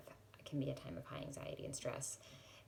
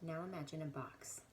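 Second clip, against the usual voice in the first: guided prayer. Real, not acted. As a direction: lead them gently and unhurriedly into prayer. Leads a guided prayer meditation.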